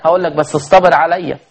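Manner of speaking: ordinary talk, nothing marked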